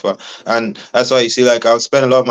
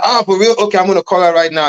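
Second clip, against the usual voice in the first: much higher, eager and quick; excited radio host; 9 semitones higher than usual. Retold or imitated. Radio host excited on air, hamming it up high. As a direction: play the thrilled host jumping on the idea. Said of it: "he was like".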